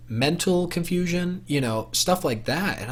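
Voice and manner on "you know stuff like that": ordinary delivery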